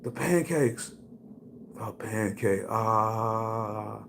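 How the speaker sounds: answering his buddy in a daze; stoned and dazed. Retold or imitated. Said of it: "I was like".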